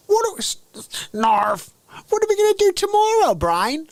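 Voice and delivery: very high squeaky cartoon mouse; loud, fast falsetto sidekick; Pinky. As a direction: play the silly mouse in a piping voice. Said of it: "I'm gonna do an impression".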